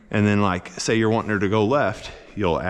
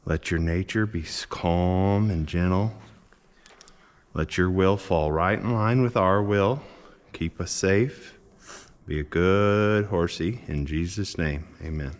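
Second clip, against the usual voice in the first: prayer; 2 semitones lower than usual. Real, not acted. Prayer over the horse.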